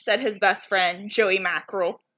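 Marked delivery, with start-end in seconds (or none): none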